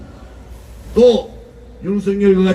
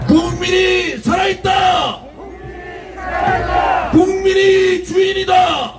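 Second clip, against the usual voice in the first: rally shout; 4 semitones higher than usual. Real, not acted. Rally speech building to climax.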